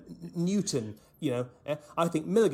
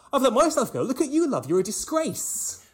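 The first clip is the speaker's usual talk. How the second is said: higher, louder, bitter lash out; Brent's spiteful retort; 10 semitones higher, 5 dB louder than usual. Retold or imitated. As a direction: spit it back at her. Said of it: "And he goes".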